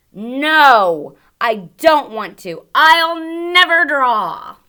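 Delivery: put-on loud and defiant, a boy's tantrum; loud stubborn refusal. Teacher voicing the boy refusing his mom loudly. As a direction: shout the refusal like a stubborn child.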